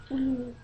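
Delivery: one high, soft whimper; mock whimper. Imitating the crying kid's whimper.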